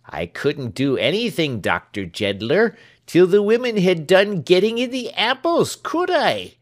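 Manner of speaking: starting near normal, climbing high and loud; servant's excuse building to a loud protest; voice rising to loud. Acted. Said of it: "his voice rising with his reasoning, so that it was very loud at last".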